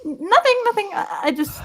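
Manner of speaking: flustered denial. Acted. Caught intruder answers the Goliath.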